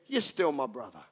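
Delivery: low, soft and tender; gentle forgiveness. Retold or imitated. Jesus answering the partner who betrayed him, gently. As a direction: say it quietly, without reproach.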